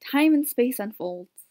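ordinary delivery

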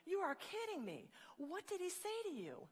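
high-pitched disbelief; acted-out astonishment; incredulous. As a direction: pitch jumps up, amazed and demanding to know. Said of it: "the hairdresser was incredulous".

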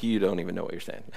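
a hint of low, soft rebuke; lightly voiced calm Jesus. Jesus answering the brothers, low and soft.

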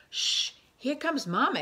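a high, urgent little shush; hissed warning. Acted. Baby bird warning siblings Mama's coming, urgent shush. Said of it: "hissed Pip".

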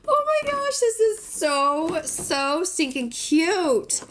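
high, slow, squealy gushing. Real, not acted. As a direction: coo over something adorable. Gushing over cute figurine.

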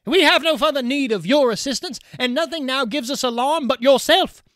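high, loud pleading voice; frightened young bride. Acted. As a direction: beg him away in alarm. Undine beseeches Kuhleborn.

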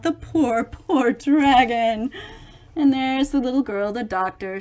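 ordinary delivery, nothing marked

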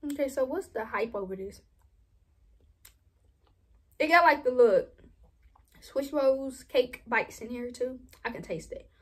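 chewing between words; talking with food in mouth; mouth full. Real, not acted. Seen 0: holds tub, spoon repeatedly in mouth while talking throughout.

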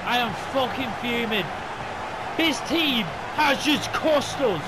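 loud, high fuming rant; angry rant. Real, not acted. Gamer fuming at his backup players, angry rant. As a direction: vent the fury at the team. Ranting after loss.

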